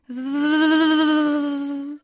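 long insect drone, swelling then easing; mosquito buzz. Acted. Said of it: "Schon wieder fliegt sie an ihm vorbei".

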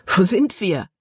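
a touch of panting; gasping. A narrator's reading. Libby asks where they are after running, gasping. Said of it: "keuchte Libby".

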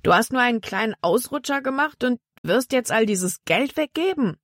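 high, rising disbelief; incredulous protest. A narrator's reading. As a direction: climb in pitch to an indignant question. Bandit protests at partner's generosity. (ungläubig und empört, immer höher)

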